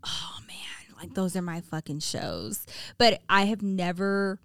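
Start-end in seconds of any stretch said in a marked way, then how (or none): 0.0-2.6 hushed whisper. Real, not acted. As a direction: lean in and whisper it. Hushed aside about favourite shows.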